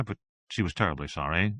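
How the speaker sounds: usual speaking voice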